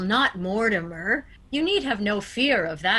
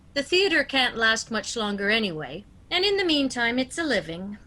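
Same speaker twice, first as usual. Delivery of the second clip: normal talking voice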